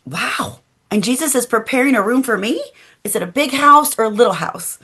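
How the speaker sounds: put-on a little girl thrilled by the news; amazed child. Child Oeli amazed about her room.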